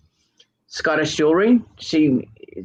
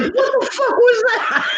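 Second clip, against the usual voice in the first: high, laughing mock shout; imitating a startled friend, laughing; slow, laughing yelp of shock; slower than usual. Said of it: "he was like".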